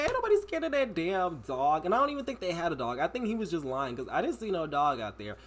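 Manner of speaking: ordinary talk, nothing marked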